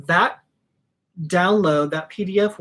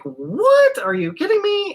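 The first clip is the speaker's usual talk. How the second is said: much higher, louder, an imitated squeal of amazement; amazed disbelief; 3 dB louder than usual. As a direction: play a delighted customer who can't believe the smell. Imagined customers smelling her accords.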